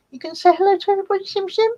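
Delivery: fast, high coaxing voice; pet talk. Real, not acted. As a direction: coax the cat to greet everyone. Baby talk to the cat.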